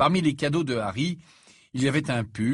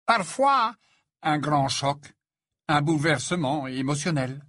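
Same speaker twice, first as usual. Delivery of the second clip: measured explanation with weighty pauses; slow voice; 3 semitones higher, 3 dB louder than usual. A narrator's reading. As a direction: give each phrase its own space. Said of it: "d'une voix lente". (voix lente, posée, entrecoupée de pauses)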